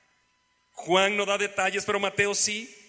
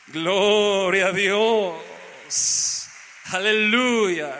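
loud, very drawn-out preacher's praise; praise exclamation; 5 dB louder, much slower than usual. Real, not acted. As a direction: proclaim it loudly, holding each vowel. Preacher exclaiming praise after reading.

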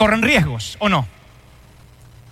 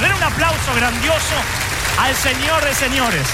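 preaching at full force; 5 semitones higher than usual. Real, not acted. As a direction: lift the crowd to their feet. Rousing applause call.

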